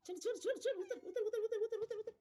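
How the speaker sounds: high, sing-song coaxing to a pet; coaxing cat. Real, not acted. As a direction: coax the cat down sweetly. (मांजराला खाली बोलावणारा उंच लाडिक आवाज)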